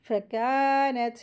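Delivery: फ़कानत with a long held kaa; stretched madd. Shown as a demonstration. Teacher models two-beat madd, फ़कानत with drawn-out kaa. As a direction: draw out the aa of फ़कानत. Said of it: "इस तरीके से".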